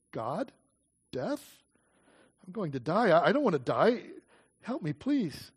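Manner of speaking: softly terrified under the water; desperate hushed plea. A narrator's reading. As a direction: pray in a faint, scared breath. Drowning girl pleads, near-breathless.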